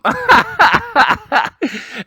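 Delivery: bright high laugh bursting out; high burst of laughter. Real, not acted. Laughing at own story.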